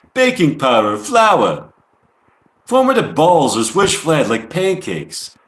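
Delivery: put-on a lightly done New York accent over a recipe list; mock New York accent. Reading [name]'s falafel recipe aloud, in a mock New York accent. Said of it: "I'm trying to speak in a New York accent like, uh, [name] would".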